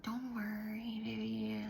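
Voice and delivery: soft mock whisper, hands on the cheeks; whispered reassurance. The girl comforting her teacher, a soft whispered reassurance. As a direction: hush it like comforting a child. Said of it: "her hands on my face and be like".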